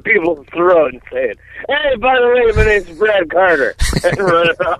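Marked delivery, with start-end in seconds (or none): 1.7-3.7 mock prank caller.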